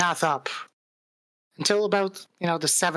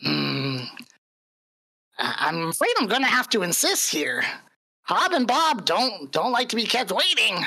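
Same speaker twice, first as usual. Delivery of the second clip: menacing goblin leader, pitched up; taunting goblin bandit; 7 semitones higher than usual. Acted. Game master voices goblin ambusher.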